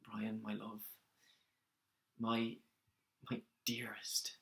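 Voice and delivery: very soft, halting, sinking to a whisper; sigh, then feel for each endearment; tender and hushed. Acted. Working up to propose to Brian, tender and hushed.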